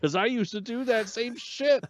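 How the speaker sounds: high-pitched, punchy retold comeback; imitated emphatic admission. Man admits his past to girl, imitated emphatic comeback. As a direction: toss back the confession emphatically. Acting Joel's blunt admission.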